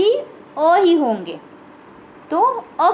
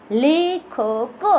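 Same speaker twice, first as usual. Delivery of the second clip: slow dictation; much slower than usual. Shown as a demonstration. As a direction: stretch out लेखक very slowly. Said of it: "अक". (बहुत धीरे खींचकर बोलना)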